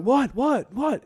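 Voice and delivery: high startled what; confused dad. Retold or imitated. Dad startled in the shower, high confused what. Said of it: "he's like".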